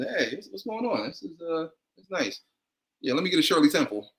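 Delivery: put-on high boyish voice, quick at the end; a young teen trying to sound casual, pitched high; squeaky kid voice. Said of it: "I had a real squeaky kid voice".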